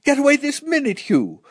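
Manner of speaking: high, sharp mother's order; urgent scolding. A narrator's reading. Mother ordering son from the window, scolding urgently. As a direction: order the child away, starting very high.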